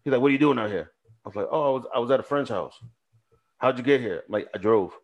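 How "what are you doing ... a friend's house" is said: ordinary talk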